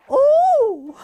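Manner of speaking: very high, loud prim "Oh!"; a shocked old British lady spilling tea; old British lady yelp. Retold or imitated. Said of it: "like some old British woman".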